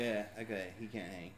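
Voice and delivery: quiet. Acted. Mutters as Raimundo.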